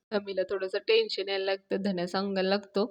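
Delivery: ordinary talk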